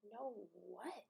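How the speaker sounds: very soft, stunned question; mock-stunned near murmur; hushed disbelief. Little sister to brother who refused her, hushed disbelief.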